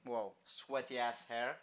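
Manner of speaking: normal talking voice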